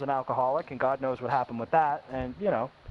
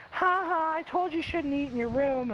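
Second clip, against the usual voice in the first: high singsong mock gloating; taunting sister imitation; 10 semitones higher than usual. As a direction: mimic a gloating sister, pitched way up.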